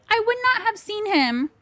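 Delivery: high-pitched, indignant retort; exasperated protest. Retold or imitated. Daughter protesting to mom about her father, exasperated.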